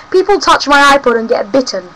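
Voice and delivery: normal talking voice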